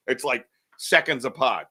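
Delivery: ordinary delivery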